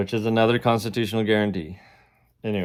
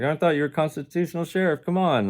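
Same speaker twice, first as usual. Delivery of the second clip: mock pleading; 7 semitones higher, faster than usual. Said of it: "I was like".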